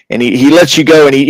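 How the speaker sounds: ordinary talk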